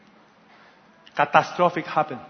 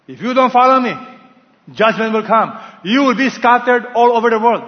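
loud, raised prophetic warning; stern warning; 5 semitones higher, 8 dB louder than usual. Acted. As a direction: thunder it out as God's warning. God warns his people, preached.

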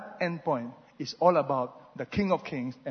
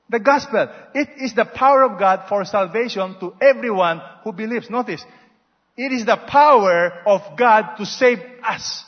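higher, louder, driving sermon voice; full-force preaching; 4 semitones higher, 6 dB louder than usual. Acted. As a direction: press the gospel home with fire.

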